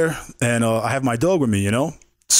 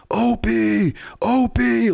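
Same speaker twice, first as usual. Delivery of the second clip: calling out for a lost dog; 11 semitones higher, much slower than usual. Retold or imitated. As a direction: yell for the missing dog across the fields. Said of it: "I'm screaming for my dog".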